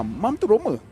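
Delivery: usual speaking voice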